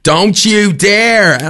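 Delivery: slow, stern Dublin mother imitating a scolding; a hint of a Dublin mum, slow and warning; Dublin accent. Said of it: "so forgive the accent".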